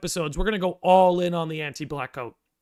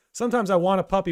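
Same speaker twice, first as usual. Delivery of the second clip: ordinary talk, nothing marked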